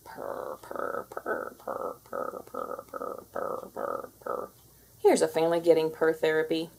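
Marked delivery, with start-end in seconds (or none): 0.0-4.5 soft unvoiced purring sounds; whispered purrs, very soft. Shown as a demonstration. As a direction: breathe a gentle cat purr. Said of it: "family in need of purr therapy".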